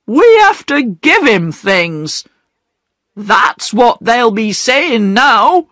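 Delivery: a proud man ashamed, lightly voiced; hurt pride. Perks explains to the children, mildly tearful.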